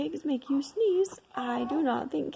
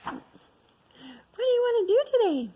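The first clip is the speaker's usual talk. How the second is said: cheerful voice; 9 semitones higher, 6 dB louder than usual. Acted. Cheerful friend voice.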